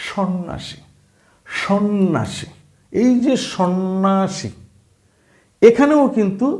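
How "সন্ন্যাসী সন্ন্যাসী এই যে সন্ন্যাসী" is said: সন্ন্যাসী said slowly with a doubled ন and no জফলা sound; slow teaching demo of সন্ন্যাসী; slow clear syllables. Shown as a demonstration. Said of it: "আরেকটা শব্দ হচ্ছে".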